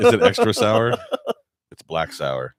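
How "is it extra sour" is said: laughing. Real, not acted. Laughing while asking.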